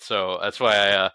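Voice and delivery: ordinary delivery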